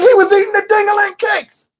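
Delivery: very high taunting yell; mocking shout. Kids roasting Steven, shouting high and mocking. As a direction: shout it out to tease him. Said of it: "Everybody was like".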